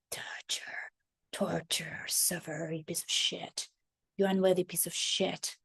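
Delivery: a cruel whisper from deep inside; inner voice whisper. Retold or imitated. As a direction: hiss the insults softly, barely voiced. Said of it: "deep down, it's like".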